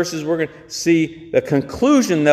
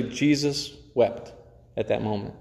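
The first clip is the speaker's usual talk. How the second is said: hushed; 6 semitones lower, 9 dB quieter than usual. Acted. Preacher reading the shortest verse to the church, hushed and solemn. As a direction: let it drop to a hush.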